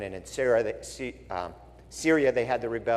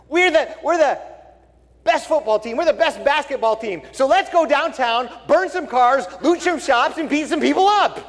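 higher, louder mock rioter boasting; 11 semitones higher, 5 dB louder than usual. Pastor mocks fans planning to riot, high gleeful mock voice.

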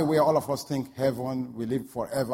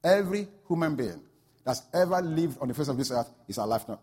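ordinary delivery

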